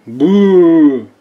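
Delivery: sudden loud playful boo; startling boo. Acted. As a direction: jump out with a spooky boo. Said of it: "did I scare you?".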